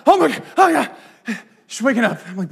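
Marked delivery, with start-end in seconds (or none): 0.1-0.9 higher and louder, imitating a panicked wake-up; mock frantic. Husband imitating his wife waking from a chase dream, mock frantic. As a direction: play the wife jolting awake from a nightmare, half mocking. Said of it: "she's just frantic".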